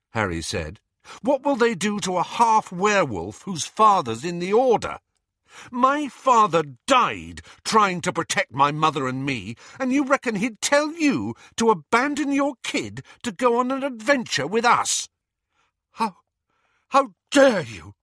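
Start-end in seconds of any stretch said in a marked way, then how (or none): 1.2-15.1 loud, much higher angry accusation; enraged. Acted. Harry accuses Lupin of abandoning his child, raging loudly. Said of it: "his rage was coming from". 15.9-17.9 louder, higher outraged stress on dare; indignant fury. Acted. Lupin furious at Harry's accusation, outraged shout. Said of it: "said Lupin".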